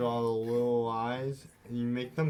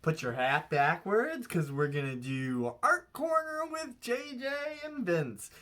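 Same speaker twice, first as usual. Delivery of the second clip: high, sing-song segment announcer; kids' show host; 6 semitones higher than usual. Pretend. Introducing Art Corner to viewers at home, sing-song host. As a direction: stretch the segment name and sign-off up high.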